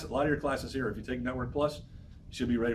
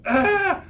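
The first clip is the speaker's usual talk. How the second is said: sudden high yelp of panic; startled yelp; 9 dB louder than usual. Retold or imitated. Student facing the exam, mock panicked yelp.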